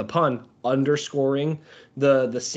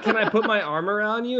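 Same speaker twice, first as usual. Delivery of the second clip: hopeful ask, laughing; 7 semitones higher than usual. Retold or imitated. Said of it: "acting out the date".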